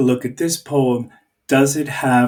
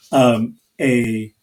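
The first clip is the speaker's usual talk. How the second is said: ordinary talk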